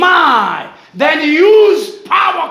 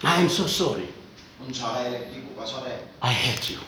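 humble apology; 13 semitones lower, 9 dB quieter than usual. Acted. Asking a wronged brother for forgiveness, soft and contrite. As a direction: hang your head and beg quietly for pardon.

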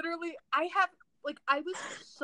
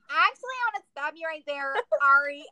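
higher-pitched dead-crow impression; mock her cutting him off, getting louder; Becca; 4 semitones higher, 5 dB louder than usual. Friend doing Becca on request, mocking impression. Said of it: "I want you to do your Becca dead crow".

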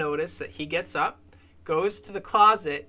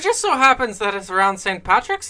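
loud, higher mock Irish; butchered Irish accent; 6 semitones higher, 8 dB louder than usual. Host explains it's Saint Patrick's Day, butchered Irish accent. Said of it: "I have a very butchered accent".

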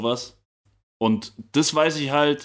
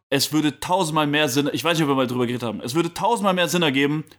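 ordinary delivery

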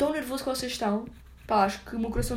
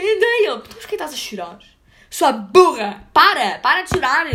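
scolding yell; 4 semitones higher, 8 dB louder than usual. Retold or imitated. Telling her off for crying.

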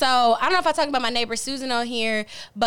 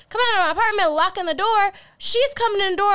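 excited; 9 semitones higher, 3 dB louder than usual. Real, not acted. Excited retelling of dog attack.

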